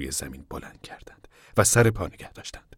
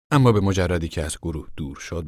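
ordinary delivery

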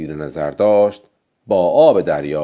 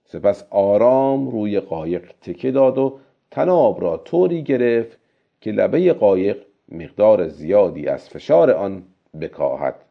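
plain reading voice

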